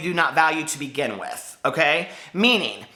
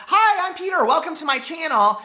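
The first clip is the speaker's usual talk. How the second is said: much higher, bright channel greeting; squeaky intro voice; 9 semitones higher than usual. Retold or imitated. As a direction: chirp the hello way up high. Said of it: "you don't have to be squeaky and high-pitched".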